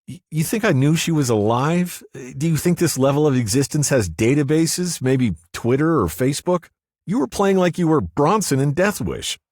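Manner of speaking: woman's voice, mock outrage; Cynthia, exasperated. A narrator's reading. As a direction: throw it back at him in disbelief.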